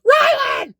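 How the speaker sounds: high shrill yell imitating mom calling his name; mom calling Rylan. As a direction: call out his name like his mom from another room. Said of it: "She sounds exactly like that".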